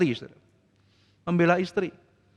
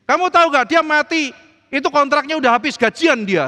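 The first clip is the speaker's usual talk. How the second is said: loud, high scornful mockery; scoffing; 9 semitones higher, 6 dB louder than usual. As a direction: laugh off her tears loudly. Mocking wife crying at drama. (suami mengejek istri yang menangis, keras dan tinggi)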